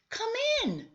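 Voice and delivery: high, bright welcoming call to the door. Acted. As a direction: sing out the welcome like a cheerful mother.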